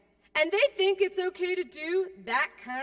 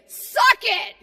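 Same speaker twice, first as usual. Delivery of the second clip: loud, higher "Suck it!" for the crowd; call-and-response shout; 8 semitones higher, 9 dB louder than usual. Pretend. Speaker leads the crowd's answer to Norquist, loud playful shout. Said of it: "play a little acting exercise".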